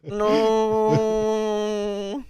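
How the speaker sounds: long, high, drawn-out 'no'; mock wail. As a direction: wail it out in joking despair.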